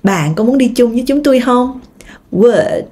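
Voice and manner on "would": "Would" starting with rounded lips w; w sound. Shown as a demonstration. Teacher isolates the w sound. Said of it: "âm này là âm w".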